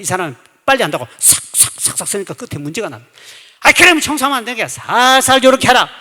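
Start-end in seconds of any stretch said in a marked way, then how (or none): 3.6-5.9 high, loud acted telling-off; sharp scolding. Deaconess correcting a fast sweeper during cleaning, sharp scolding. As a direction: scold a careless sweeper loudly.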